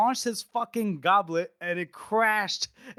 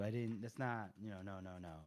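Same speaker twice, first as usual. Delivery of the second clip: fading sheepish denial; 9 semitones lower, 18 dB quieter than usual. Retold or imitated. King trailing off cowed.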